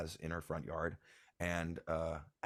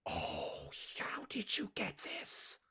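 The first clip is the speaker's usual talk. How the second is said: slow, high, whispery amazement; hushed astonishment; 14 semitones higher, 5 dB quieter, slower than usual. Acted. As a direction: breathe it out slowly in disbelief. Mother astonished by unwrapped gift.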